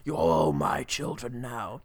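slow low creepy voice; menacing Freddy. Acted. Mock Freddy tells the girls they're his, slow and low. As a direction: lean in like Freddy claiming the kids.

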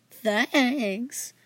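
drawn-out sarcastic thanks. Acted. Kids thank leprechaun after the glitter blast, sarcastic. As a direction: stretch it out with mock gratitude. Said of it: "we said sarcastically".

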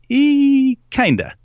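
high, drawn-out squeak dropping into "kinda"; mock a squirming, noncommittal answer; hesitant hedging. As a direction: stall on a high "eee", then admit it. Recruiter hedging in retold talk.

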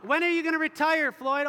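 loud, high-pitched casual grilling; acted loud question. Acts out asking Floyd.

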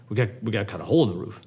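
rushed, low insistence; fast repeated plan. Acted. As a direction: repeat it quickly and firmly.

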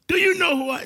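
high, loud mock indignation; mock indignant Jesus. Preacher jokes Jesus got huffy with Martha, high loud mock. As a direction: play up a haughty comeback for a laugh. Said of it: "no, he didn't say that".

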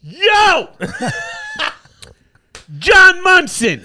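high, loud call down the hall, slow and laughing; yelling a name. Retold or imitated. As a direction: shout after him to stop him. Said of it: "And I just yell".

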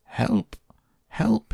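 high mock yell. A narrator's reading. Drowning boy calling for rescue, high mock yell. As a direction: cry out shrilly without much volume. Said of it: "he yelled".

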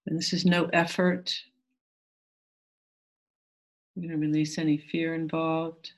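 calm, gentle meditation guide. Acted.

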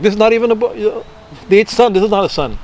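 high, put-on scoffing voice; a lightly voiced king brushing off the baby; mock dismissive Kamsa. Kamsa scoffing that the baby is no son, high mock voice.

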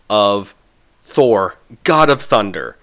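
ordinary talk

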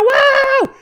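put-on very high, loud drunken whoop; mocking high-pitched drunk squeal; drunk Amber. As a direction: shriek it like a super drunk woman. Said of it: "that's how she sounds when she's drunk".

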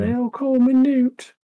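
hammed-up child voice, slow; imitating Newt. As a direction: do the kid introducing herself. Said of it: "she had the British accent".

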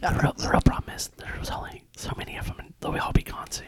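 whisper yelling. Real, not acted. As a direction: whisper it with force. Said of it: "Why are we whispering?".

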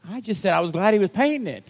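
high-pitched mock defensiveness; imitating a defensive reply; retold protest.

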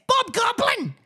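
very high goblin-like squawk; put-on voice. Shown as a demonstration. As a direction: squeal it out in falsetto. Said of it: "what, what's next?".

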